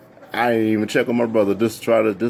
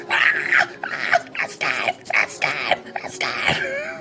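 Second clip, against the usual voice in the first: whispered then squeaking, crying "I'm scared"; overwhelmed whimper; 3 dB quieter, much slower than usual. Retold or imitated. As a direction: whimper like a starstruck fan near tears. Overwhelmed fan meeting idols.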